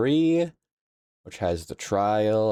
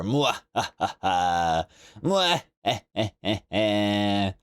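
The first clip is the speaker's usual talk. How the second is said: mock evil laugh; slower than usual.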